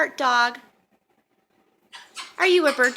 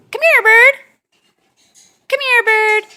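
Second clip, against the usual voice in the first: loud, high calling; 3 semitones higher, 8 dB louder than usual. Real, not acted. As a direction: call out to the bird. Calling bird to come over.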